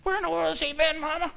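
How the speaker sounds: high-pitched wail of upset kids, imitated; mock crying daughters. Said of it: "they're crying, going".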